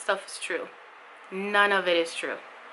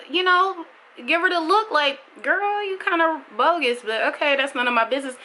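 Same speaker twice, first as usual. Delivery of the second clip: high sassy sing-song, much higher; sassy aside; 8 semitones higher than usual. Real, not acted. Mother advising viewers on a mom who beats her kids, sassy and high. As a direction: throw shade lightly.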